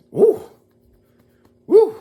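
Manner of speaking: high, loud whoop; delighted woo; excited cheer. Real, not acted. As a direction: whoop it up for the finale. Cheering the coming finale.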